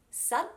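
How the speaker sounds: "sud" stopped on an unreleased D; "sud" cut off before release; first syllable alone. Shown as a demonstration. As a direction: say just "sud", tongue held on the D. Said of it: "after that first syllable".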